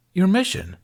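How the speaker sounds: high, bright eager answer; beaming. A narrator's reading. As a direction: beam it out proudly. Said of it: "the learner beamed".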